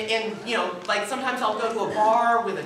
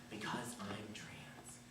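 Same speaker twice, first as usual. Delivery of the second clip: slow hushed whisper; very soft, almost breathed; 10 semitones lower, 19 dB quieter, much slower than usual. Real, not acted. Confessing quietly.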